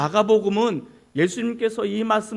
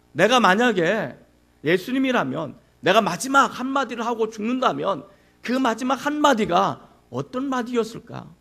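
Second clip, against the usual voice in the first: normal talking voice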